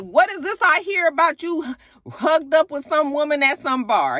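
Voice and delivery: high, pressing accusation; accusing girlfriend. Retold or imitated. Sierra confronting boyfriend on the phone, high accusing tone. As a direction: demand answers from a cheater.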